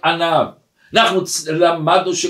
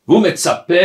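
ordinary talk, nothing marked

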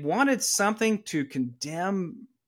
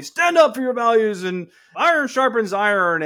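loud, high, hammed-up pep talk; mock rousing exhortation; 7 semitones higher, 8 dB louder than usual. Someone advising a student before high school, mock rousing exhortation. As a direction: belt it out like a mock motivational slogan.

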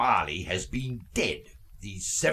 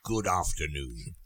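softer and lower, a curt goodbye; cold dismissal; 8 semitones lower, 6 dB quieter than usual. Acted. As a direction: shut the talk down icily. Scrooge dismisses nephew coldly.

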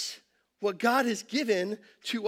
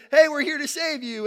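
raised, higher hail across the water; calling out from ship; 6 semitones higher, 6 dB louder than usual. Retold or imitated. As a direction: shout it over to the man in the sea. Ship crew calling to drowning pastor.

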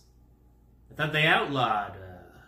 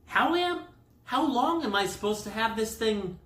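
high, mock-nervous asking; acted-out uneasy patient; anxious question; 8 semitones higher than usual. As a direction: ask it high-pitched and worried. Acting his anxious question to nurse.

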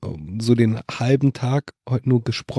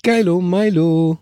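playful; 5 semitones higher, slower than usual. Pretend. Playful rhyme slowly drawn.

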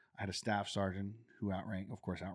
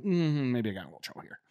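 wry self-aware; 4 semitones higher, 8 dB louder than usual. Retold or imitated. Inner realization of trouble.

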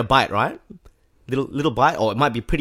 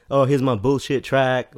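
higher, louder, slow dismissive shrug of a line; playing it down; 5 semitones higher, 6 dB louder, slower than usual. Rapper downplaying his new track to friends, mock dismissive. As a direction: brush off your own song as nothing. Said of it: "I'd play it down".

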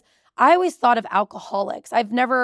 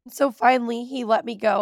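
ordinary talk

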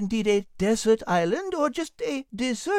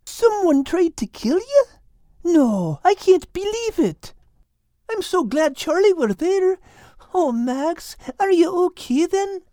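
a touch of alarmed relief, louder; 5 semitones higher, 5 dB louder, slower than usual. Acted. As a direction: gasp it out in shocked relief. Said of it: "in alarm and relief".

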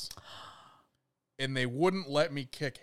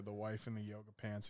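ordinary talk

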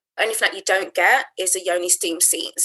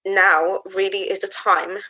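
solemn affirmation. Real, not acted. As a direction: speak each line as a sacred promise. Slow solemn affirmations, long pauses.

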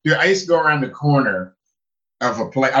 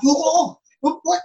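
high-pitched mock double take; retold startle, voice jumping up; startled. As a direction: act out being taken aback. Acting out shock at onlookers.

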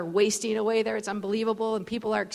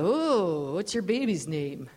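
put-on lower, swooping drunk question; slurring drunk; 3 semitones lower, slower than usual. Drunk man asking a mother about her baby, slurred and curious. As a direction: slide up and down, lightly slurred. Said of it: "just the slur stopped".

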